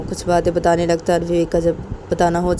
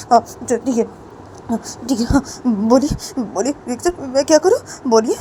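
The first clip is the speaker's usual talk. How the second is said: lightly voiced student, higher and halting; compliant Samar; 6 semitones higher, 4 dB louder than usual. A narrator's reading. Student wipes his tears and asks his teacher what to do, compliant. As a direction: pull yourself together and ask for instructions.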